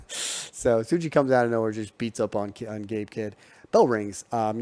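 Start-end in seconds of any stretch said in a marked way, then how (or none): none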